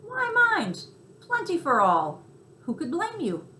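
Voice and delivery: high, loud eager sympathy; heartily on his side; sympathetic mouse. Acted. Tucker backs Chester's picnic raid, high and hearty. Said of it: "said Tucker Mouse sympathetically".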